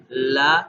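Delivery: "لا" said clearly with the tongue tip up; la sound. Shown as a demonstration. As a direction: clean short "لا". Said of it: "آواز ہوگی اس کی".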